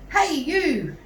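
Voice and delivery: loud sharp call. A narrator's reading. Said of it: "Missus Cripps called".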